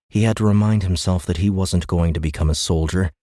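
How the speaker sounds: plain reading voice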